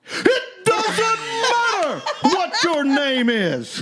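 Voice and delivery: high, drawn-out mock holler; wrestler catchphrase. Joker answering as a showboating wrestler, hammed-up holler. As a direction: cut him off with a long hammed-up bellow.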